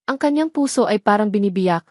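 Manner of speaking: plain reading voice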